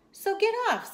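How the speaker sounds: high-pitched, loud acted command; indignant order. Hat ordering the cat off the mat, indignant order. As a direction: yell it high as a storybook character.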